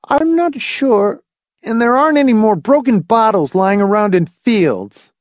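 put-on high, boyish resentful complaint. A narrator's reading. Boy listing losses to his mother, high and resentful. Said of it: "David complained resentfully".

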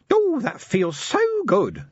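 high, slow, contented little imp; tiny imp voice. Acted. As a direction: sigh into it like a tiny creature. Imp speaks.

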